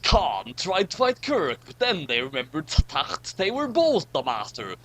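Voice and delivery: ordinary delivery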